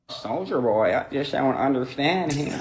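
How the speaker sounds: low, soft impression of a rapper. Comic imitating a rapper puzzled by [name], low impression. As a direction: drop low and quiet to imitate him. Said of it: "You kinda got [name]'s voice down".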